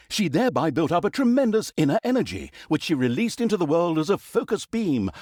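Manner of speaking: normal talking voice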